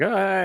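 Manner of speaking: high, loud panicked scream; scared scream. Retold or imitated. Delivery guy falling off the porch, screaming high and loud. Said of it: "The dude's like".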